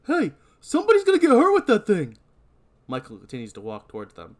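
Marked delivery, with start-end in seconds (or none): 0.1-2.2 high, loud warning shout; loud warning call. Acted. Deputy warning Michael off from the car.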